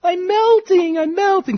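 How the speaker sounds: high, loud melting witch cry. Retold or imitated. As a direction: wail it like the witch dissolving. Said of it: "and the witch goes".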